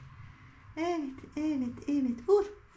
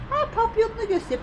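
ordinary talk, nothing marked

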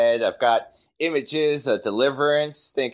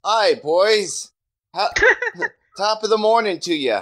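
a higher, hammed-up fake Texan greeting; mock Texas accent; 8 semitones higher than usual. Mocking the cops' fake Texan greeting, laughing through it. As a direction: ham up a bad Texan hello, breaking into a laugh. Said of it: "this is how they talk in, in Texas".

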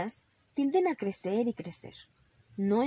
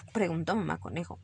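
ordinary delivery

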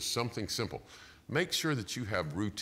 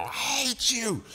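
much higher, slow mock toddler wail of I hate you; screaming toddler; 5 dB louder, slower than usual. Toddler in the buggy screams at his mom, high mock wail. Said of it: "he's trying to kick his mom".

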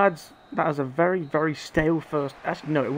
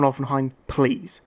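ordinary talk, nothing marked

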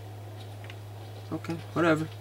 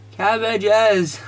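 loud, high, hammed-up cry; mock-excited announcement; 6 semitones higher, 5 dB louder than usual. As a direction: proclaim it with silly gusto. Playfully announces cabbages in the cart.

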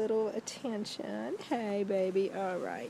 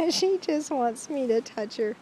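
usual speaking voice

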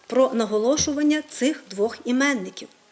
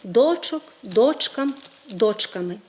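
ДОЧок, ДОЧкам, ДОЧками; stress on first syllable. Shown as a demonstration. Teacher listing plural forms, stress moved to the first syllable. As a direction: hit ДОЧ at the start of each word. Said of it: "наголос переходить на перший склад".

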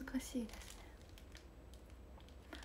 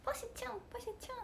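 much higher, soft call of a name; impression; 12 semitones higher, 11 dB quieter, much faster than usual. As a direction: call sweetly in someone else's voice. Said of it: "これ人間のモノマネです".

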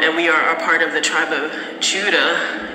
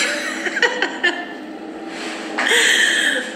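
breathy hushed giggle; 13 semitones higher than usual. Real, not acted. Hiding in the bathroom at work.

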